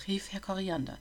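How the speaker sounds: usual speaking voice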